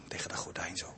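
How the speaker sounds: soft whisper behind a curtain. Retold or imitated. Father hiding behind the curtain, whispered.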